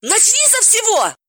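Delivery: very high, eager cry; eager exclamation. Acted. Galina begs Dalila to tell everything, very eager. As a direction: burst out with curiosity. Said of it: "с азартом воскликнула".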